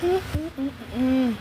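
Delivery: humming while gulping down vitamins; swallowing pills. Real, not acted. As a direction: hum through a mouthful of pills. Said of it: "I'm in the middle of taking my vitamins".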